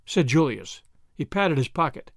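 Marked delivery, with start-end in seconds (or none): none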